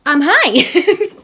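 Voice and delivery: bubbly high hello with a laugh; excited greeting. Real, not acted. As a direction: burst in cheerfully. Bursts into real bubbly greeting.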